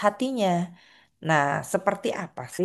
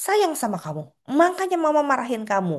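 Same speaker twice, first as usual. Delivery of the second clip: much higher, sing-song scolding mom; a mother justifying her scolding, pitched way up; mock scolding mom, high voice; 9 semitones higher than usual. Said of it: "kan kita bilang".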